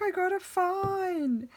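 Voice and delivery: high, slow wailing daughter voice; mock sobbing kid's voice; imitating crying daughter. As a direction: mimic a tearful teen, drawn out high. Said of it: "in floods of tears".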